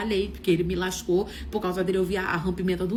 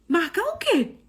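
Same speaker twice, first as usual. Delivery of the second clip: baffled reply; 8 semitones higher, 4 dB louder, slower than usual. Retold or imitated. Confusa com o convite.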